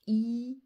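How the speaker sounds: loud, stretched "Ira": long i, rolling r, then a; syllabe décomposée. Shown as a demonstration. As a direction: sound out "Ira" slowly, holding i and r. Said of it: "nous allons écrire la syllabe ira". (« Ira » fort et étiré, i et r prolongés)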